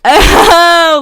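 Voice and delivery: loud high excited cry; excited shout. Real, not acted. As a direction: shout it out with joy.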